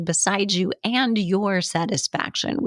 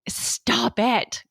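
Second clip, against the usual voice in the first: higher, curt mock scolding; blunt retort; 5 semitones higher than usual. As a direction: snap it like a fed-up coach. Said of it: "You can't simply say".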